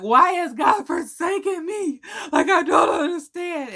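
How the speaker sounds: high, slow, wailing lament with a cry; hammed-up tearful wail; mock boohoo crying. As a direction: sob it out theatrically to mom. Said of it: "I'm boohoo crying".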